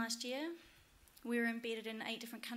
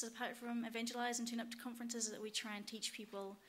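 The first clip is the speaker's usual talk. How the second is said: ordinary talk, nothing marked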